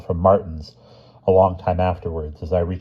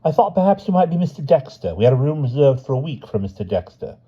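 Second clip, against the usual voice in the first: porter voice; 7 semitones higher than usual. A narrator's reading. Porter voiced, high pitch.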